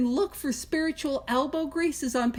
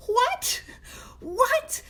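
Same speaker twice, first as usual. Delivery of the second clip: high squeaky double take, playfully incredulous; a pitched-up what, what in mock shock; mock disbelief; 12 semitones higher than usual. To the group, acting shocked at the radical prayer, high mock disbelief.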